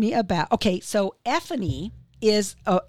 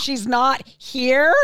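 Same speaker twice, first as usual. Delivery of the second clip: slow, louder, rising disbelief; shocked disbelief; 6 semitones higher, 6 dB louder, much slower than usual. Retold or imitated. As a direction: drag it out, stunned she left. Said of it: "I said".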